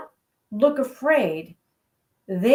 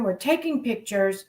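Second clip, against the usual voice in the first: ordinary talk, nothing marked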